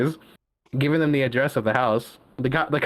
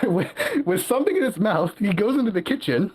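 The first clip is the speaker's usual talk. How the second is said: higher voice breaking into real giggles; laughing while talking; 6 semitones higher than usual. Real, not acted. Telling a friend about the hot dog thief, cracking up. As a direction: barely get the story out for laughing. Cracking up at own chihuahua anecdote.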